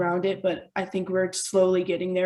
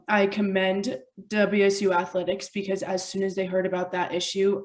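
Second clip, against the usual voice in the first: ordinary delivery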